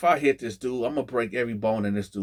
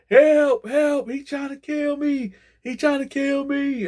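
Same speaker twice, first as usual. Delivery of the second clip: high, loud mock scream for help; old man screams down the tier, high and loud; 14 semitones higher, 4 dB louder, slower than usual. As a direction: holler it down the tier like you're being dragged. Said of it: "screaming, hollering".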